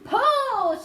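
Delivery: high, loud drawn-out cry; shouted command. A narrator's reading. James signalling the crew to pull, sharp shout. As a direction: give the signal at full voice. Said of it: "shouted James".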